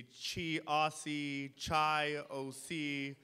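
her name mangled slowly, as hosts get it wrong; mispronounced name. Retold or imitated. Councilwoman mocking how hosts slowly garble her name. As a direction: drag out "Chi Aussie, Chai O C" the wrong way, very slowly. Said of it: "every event that people introduce me, they say".

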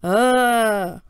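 loud, drawn-out "ugh" of fright; startled "ugh". A narrator's reading. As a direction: blurt a scared-movie "uhh". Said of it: "but I kinda went".

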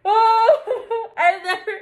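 high sob mixed with laughter; overwhelmed, loud tearful cry. Real, not acted. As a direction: laugh through real happy tears. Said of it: "I don't think I've ever cried".

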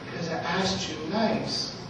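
quietly accuse, like a wounded little daughter; tearful little girl. Retold or imitated. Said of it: "She's got these little alligator tears".

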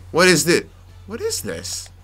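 normal talking voice

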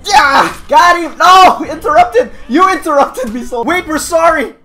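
high, loud battle yells; frantic shouting. Real, not acted. Gamer interrupted mid-attack, frantic loud yelling. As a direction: shout in the heat of the fight.

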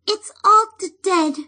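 high, broken, tearful fairy voice; weeping fairy. Acted. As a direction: weep it out like a tiny sprite. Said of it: "wept the fairy".

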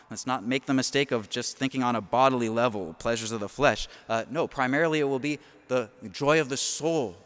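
usual speaking voice

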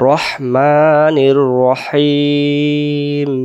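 রহমানির রহিম chanted slowly with drawn vowels; correct Quranic recitation. Shown as a demonstration. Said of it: "আমরা বলবো".

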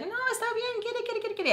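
very high, eager imitation of her friend. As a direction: squeal it like an overeager generous friend. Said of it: "And she's like".

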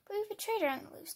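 usual speaking voice

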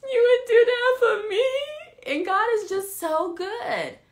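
high, loud laughing sob; overwhelmed, cry-laughing at God's goodness; real tearful cry. Real, not acted. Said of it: "baby crying".

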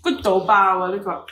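put-on loud Hong Kong accent with a Cantonese tag; excited Cantonglish. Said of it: "Cantonese English".

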